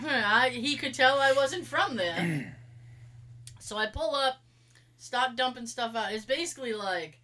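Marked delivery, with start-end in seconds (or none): none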